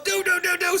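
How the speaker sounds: high, rapid-fire mock pleading call; retold urgent calling. Off-duty cop flagging down officer, retold urgent calling. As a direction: call out to head him off, high-pitched.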